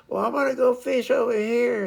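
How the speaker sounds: high-pitched imitation of a wayward teammate; carefree teammate. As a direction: toss it out high, like a kid wandering off. Acts out the wandering teammate.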